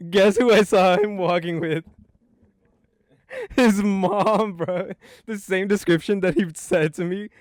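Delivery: high, loud, excited punchline reveal; amused disbelief. Real, not acted. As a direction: deliver the reveal loudly, close to laughing. Laughing reveal of the living mother.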